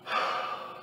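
a long unvoiced sigh through tears; shaky tearful sigh. Real, not acted. Lonely vlogger sighing to the camera through tears. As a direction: let out a breath that almost breaks into a sob. Crying while confessing loneliness.

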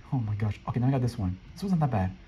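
usual speaking voice

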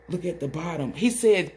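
ordinary delivery

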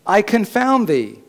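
high, loud threatening voice; stern warning God. Acted. Preacher voicing God warning the disobedient, loud and stern. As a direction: warn like a father about to punish. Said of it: "He says".

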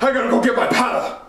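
ordinary talk

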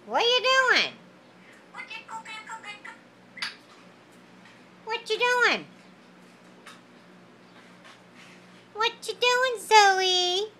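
put-on high sing-song baby talk. Talking to the bird/baby.

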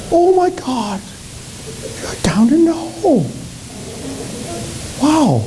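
high-pitched, exclaiming woman's voice; female passerby. Acted. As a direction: play a woman aghast at what she finds. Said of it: "a female walked by".

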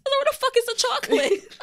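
loud, high-pitched comic indignation; mock outrage. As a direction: demand it in exaggerated disbelief, way up high. Acts out finding no chocolate.

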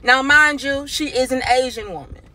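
ordinary talk, nothing marked

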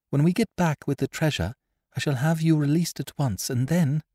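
put-on a young woman justifying herself, urgent; young woman, earnest. A narrator's reading. Polly speaks at length.

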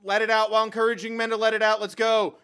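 pumped-up loud cheering; hyped yelling. Real, not acted. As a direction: shout your hype at the screen. Hyped by the singing.

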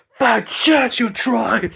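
high, loud angry shout; General Ogre's menacing outburst. Acted. Ogre turns on Izuku as his tone changes, angry shout. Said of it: "demeanor and tone of his voice change".

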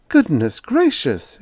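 high, slow mild exclamation; a touch of nosy wonder; curious surprise. A narrator's reading. Landlady marvels at dirt falling from the box, high and slow. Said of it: "following him into his room curiously".

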